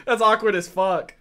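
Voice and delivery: telling it through real laughter; a sudden high burst of laughter; laughing. Real, not acted. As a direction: laugh at the awkward twist. Amused by the awkward reveal.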